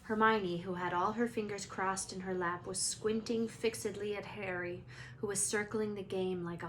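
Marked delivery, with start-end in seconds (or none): none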